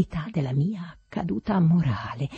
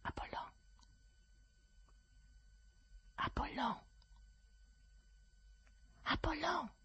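soft, high-pitched separated calls; hushed summons; 18 dB quieter than usual. A narrator's reading. Master behind the screen summons his servant, hushed. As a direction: call the name softly, with weight.

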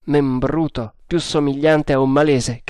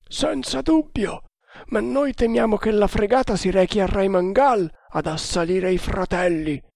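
much higher, hushed Indian sailor; conspirator voice; 7 semitones higher than usual. A narrator's reading. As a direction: whisper like a loyal plotter.

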